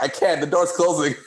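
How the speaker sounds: put-on panicked little kid. Boy trapped on the train calls to his siblings, panicked. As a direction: replay a scared nine-year-old stuck on the train. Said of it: "I'm like".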